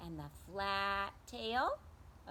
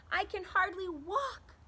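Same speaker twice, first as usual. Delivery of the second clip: high, loud, whimpering Bird voice; a hurt bird complaining through tears; tearful little bird, pitched up; crying Bird; 10 semitones higher, 6 dB louder than usual. Acted. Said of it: "And he's still crying".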